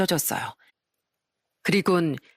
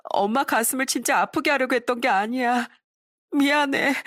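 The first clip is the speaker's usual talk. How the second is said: put-on higher, tearful girl pleading sorry; a daughter weeping, mildly acted; sobbing apology; 6 semitones higher than usual. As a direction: cry through the words. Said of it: "오열을 하기 시작했죠".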